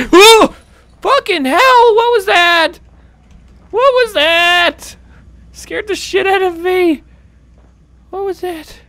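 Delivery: high loud frightened shrieks; terrified screaming. Real, not acted. Gamer screaming after a table is flipped.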